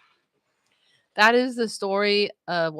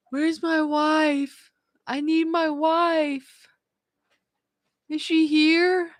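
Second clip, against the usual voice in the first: high, slow, drawn-out plea; groggy husband; 9 semitones higher, much slower than usual. Retold or imitated. Just out of surgery, husband calls for his wife, groggy high wail. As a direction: imitate a woozy husband wailing for his wife. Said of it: "he had no idea he was saying it".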